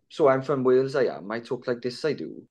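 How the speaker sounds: mock Welsh accent. Shown as a demonstration. Showing how they mock the accent, caricature Welsh accent. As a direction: take the piss with a Welsh accent. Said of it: "taking the piss out of the accent".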